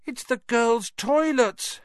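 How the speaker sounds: high, slow, gasping realisation; gasped alarm. Acted. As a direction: gasp it out in shock. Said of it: "Harry gasped".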